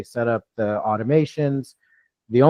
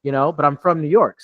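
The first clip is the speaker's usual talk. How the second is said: usual speaking voice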